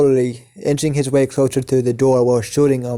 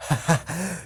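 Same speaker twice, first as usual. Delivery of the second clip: short, quiet mock laugh, pitched higher; acted villain laugh; 5 semitones higher, 7 dB quieter than usual. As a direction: chuckle as the amused Joker. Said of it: "As he laughs at that".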